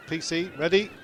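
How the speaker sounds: ordinary talk, nothing marked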